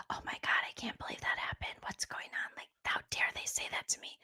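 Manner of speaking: quick, gossipy whisper; demonstrating everyday hushed chatter; ordinary whisper. Retold or imitated. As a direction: whisper it in a normal conversational flow. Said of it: "Everyone else's whisper is kinda like".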